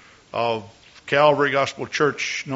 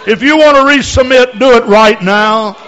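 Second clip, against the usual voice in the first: loud, high fervent altar call; preaching altar call; 10 semitones higher, 15 dB louder than usual. Real, not acted. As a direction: urge them forward with full force. Altar call at full force.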